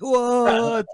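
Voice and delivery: high-pitched hammed-up disbelief; mock shock. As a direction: play it aghast, voice shooting way up. Acting out a startled reply.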